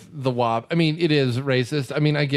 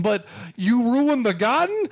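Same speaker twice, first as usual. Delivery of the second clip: higher, slow indignant Brooklyn wiseguy, rising on garden; mock Brooklyn tough guy; 6 semitones higher than usual. Mocking the movie's Brooklyn character, slow indignant wiseguy. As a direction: drag out the outrage over garden. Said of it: "him going".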